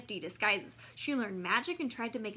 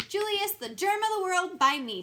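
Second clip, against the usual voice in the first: grand announcement; 9 semitones higher, 7 dB louder than usual. Acted. Mock grand title.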